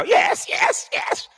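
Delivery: very high squealed yeses; squeaky fake enthusiasm; mock phony agreement. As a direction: parody her gushing in falsetto. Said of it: "Look at the way she sound now".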